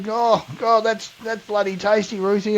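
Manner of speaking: high, soft mock savouring; imitated gushing praise. Diners on camera compliment the cook, high mock appreciation. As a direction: act out a diner relishing every bite.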